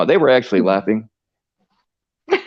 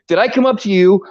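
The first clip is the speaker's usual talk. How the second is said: ordinary talk, nothing marked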